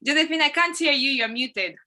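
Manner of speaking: usual speaking voice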